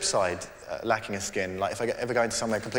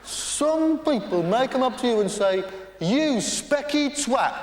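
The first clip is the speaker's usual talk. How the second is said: mock a smug TV interviewer, very high; Brian Walden impression; 12 semitones higher than usual. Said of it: "You see him do that?".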